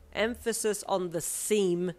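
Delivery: "seem" drawn out and loud; stress on SEEM. A narrator's reading. You correct her about parents, heavy stress on "seem". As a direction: lean hard and slowly on "seem". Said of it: "with air quotation marks around the word".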